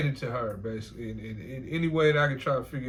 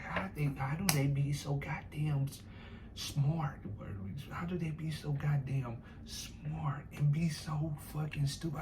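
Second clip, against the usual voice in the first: hushed bafflement; 6 dB quieter than usual. Retold or imitated. Retold woman's baffled reaction.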